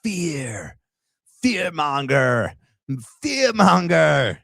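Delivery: long drawn-out words, rising in pitch and force; mock fearmongering. Jeering at a political clip with drawn-out mock alarm.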